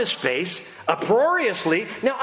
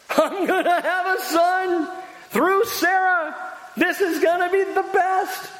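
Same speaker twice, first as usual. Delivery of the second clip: joyful laughing Abraham; 11 semitones higher than usual. Acted. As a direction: laugh out the good news. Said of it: "Joyful, excited".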